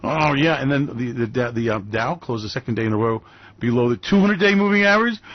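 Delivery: ordinary talk, nothing marked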